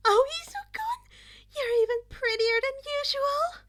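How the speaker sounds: put-on very high, loud, swooning girl's voice; breathy girl. Toga swooning over Izuku, very high and loud. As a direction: gush over him, starry-eyed. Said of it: "she breathed".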